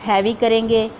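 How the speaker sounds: ordinary delivery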